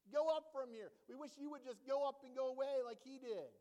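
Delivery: loud sneering taunt; mocking jeer. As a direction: jeer him away like the other prophet. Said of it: "They begin to mock Elisha".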